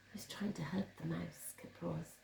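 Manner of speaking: hushed voiceless aside; whisper. Acted. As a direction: whisper it to the children.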